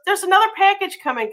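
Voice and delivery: a hint of the husband's fast announcement; mock husband warning of another package; imitating husband. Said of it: "My husband goes".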